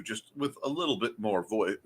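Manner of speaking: ordinary delivery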